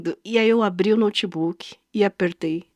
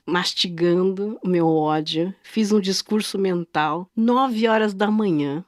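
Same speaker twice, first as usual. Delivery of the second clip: ordinary talk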